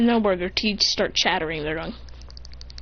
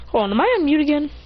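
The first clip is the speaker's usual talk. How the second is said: plain reading voice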